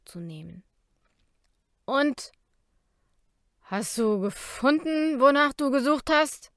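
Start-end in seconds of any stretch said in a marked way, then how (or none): 1.8-6.5 put-on loud drunken growl, slurring; barfly. A narrator's reading. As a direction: growl it out, heavy-tongued and loud. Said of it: "knurrte Jacob schließlich mit schwerer Zunge".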